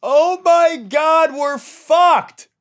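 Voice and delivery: high, loud, drawn-out wail; hammed-up anguished shouting; despairing yell. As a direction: scream it slowly like all hope is gone. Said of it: "I've been yelling for the past five minutes".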